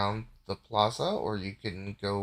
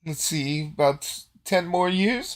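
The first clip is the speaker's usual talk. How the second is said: high, loud character voice; put-on parting jab, pitched up; smug farewell; 6 semitones higher, 7 dB louder than usual. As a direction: sign off in a raised, theatrical voice. Game master voices departing character to Gain.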